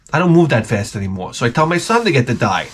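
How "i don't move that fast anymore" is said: ordinary delivery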